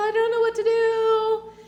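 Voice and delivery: high sing-song mock helplessness; overwhelmed disciples. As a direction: lilt it like someone frozen and lost. Acted overwhelmed quote.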